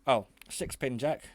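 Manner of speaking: usual speaking voice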